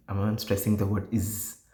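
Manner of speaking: ordinary talk, nothing marked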